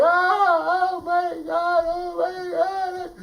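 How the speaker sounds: high, drawn-out acted wail; boy's hammed-up lament; wailing despair. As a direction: wail as if all is lost. Kofi despairs over lost sheep.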